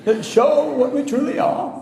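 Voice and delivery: put-on high, slow, drawn-out wise old wizard; Dumbledore impression. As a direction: stretch every word like a grave old mentor. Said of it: "he always draws out his words".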